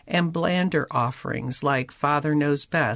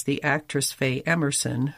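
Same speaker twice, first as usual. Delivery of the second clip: ordinary delivery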